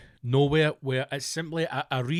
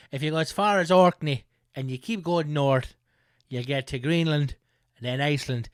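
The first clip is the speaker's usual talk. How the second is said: a bit Irish. Acted. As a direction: ramble on like a cheesy Irish folk act. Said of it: "they got a bit Irish".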